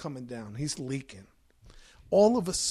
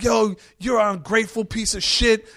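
higher, louder furious scolding; Bill yelling at his ungrateful brother, higher and louder; angry rant; 6 semitones higher, 9 dB louder than usual. Retold or imitated. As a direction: tear into him for ingratitude. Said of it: "he's like".

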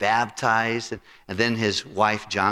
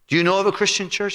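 imitating the old friend; 11 semitones higher, 4 dB louder, faster than usual. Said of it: "He goes".

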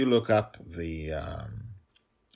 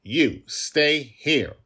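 higher, loud, very slow order; child's command; 9 semitones higher, 10 dB louder, much slower than usual. Acted. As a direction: pin the genie in place with a command. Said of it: "Dragon Little commanded".

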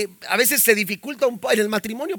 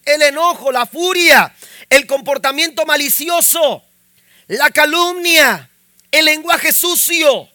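raised, loud scripture proclamation; forceful preaching; 4 semitones higher, 4 dB louder than usual. Real, not acted. Preacher reading Paul's list of sins to church, raised and forceful. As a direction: hammer out each item to the congregation.

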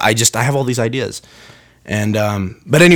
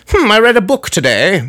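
wise British voice; 7 semitones higher than usual. Retold or imitated. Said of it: "that's a wise British person".

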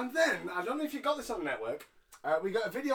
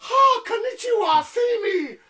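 mock Cena shout; 11 dB louder than usual. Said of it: "he goes".

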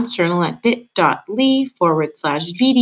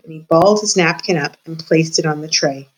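ordinary delivery, nothing marked

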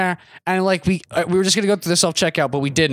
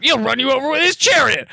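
higher imitation of a raving stranger; mock-crazed warning, pitched up; ranting man; 6 semitones higher, 3 dB louder than usual. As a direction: rave it like the bus station ranter. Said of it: "He's like".

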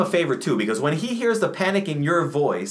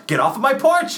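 second, more frantic order off the porch; 9 semitones higher than usual. Acted. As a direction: scream him off the porch. Repeated, higher and louder.

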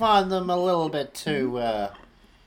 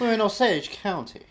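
put-on southern accent; 6 semitones higher than usual. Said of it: "I'm doing a southern accent".

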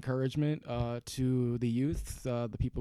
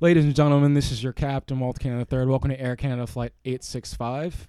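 louder, formal airline captain address; pilot announcement; 7 dB louder than usual. Pretend. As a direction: welcome the cabin like a captain. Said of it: "Do it right now".

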